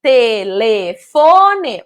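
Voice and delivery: "telefone" drawn out slowly in separate syllables. Shown as a demonstration. Teacher giving children a word to join up, "telefone" syllable by syllable. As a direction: break "telefone" into slow, clear syllables. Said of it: "eu vou dizer os nomes que representam essas figuras de uma forma silabada".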